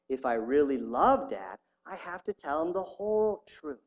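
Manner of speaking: steady, earnest reading aloud; story reading. Acted. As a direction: read the testimony with weight. Reading the story, Bible verses.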